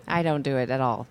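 ordinary talk, nothing marked

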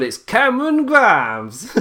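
high, drawn-out hammed-up name call; put-on announcer-like voice. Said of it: "Me saying I'll stop the voices".

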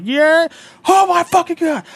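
high, loud, furious shout; livid cashier. Retold or imitated. Livid cashier at the returning customer, furious shout. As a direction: explode with rage at the window. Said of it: "gets fucking livid".

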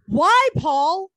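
high, loud, panicked questioning; retold alarm. Retelling a frightening phone call from friend's husband, alarmed. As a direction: demand the bad news, acting out the panic. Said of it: "literally, I think I had, like, a heart attack".

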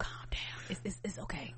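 soft, voiceless whisper; mock whispering. Co-host joining the mock argument, soothing whisper. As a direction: whisper it as if hushing someone. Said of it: "whispering to each other, but intensely".

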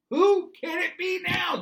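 high, loud, slow shout. Retold or imitated. Same question shouted.

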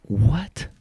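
high, sharp question of disbelief; stunned what. Retold or imitated. Veteran hearing she saw his crash, stunned. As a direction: blurt it out, floored.

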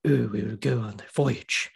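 a clumsy Welsh accent like a bad actor; a parody of an American doing Welsh; mock Welsh accent. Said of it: "The Welsh accent is the most complicated".

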